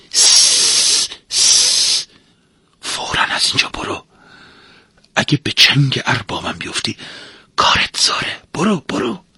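urgent whisper to shoo someone away; shush, then whisper a secret warning; hushed warning. A narrator's reading. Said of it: "آروم گفت".

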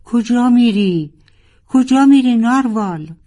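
high, loud cry after him; desperate high cry after a departing friend; shrieking call. A narrator's reading. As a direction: call out in alarm. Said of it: "جیغ کشید".

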